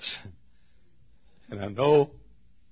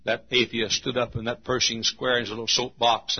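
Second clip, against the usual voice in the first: normal talking voice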